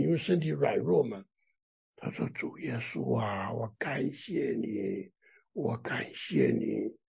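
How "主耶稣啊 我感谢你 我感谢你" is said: very soft, slow and low, drained of strength; weak, faint voice. Retold or imitated. Sick believer praying at the back of the meeting, faintly. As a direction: imitate a frail, barely audible prayer. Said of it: "他用很微弱的声音，因为身体软弱嘛".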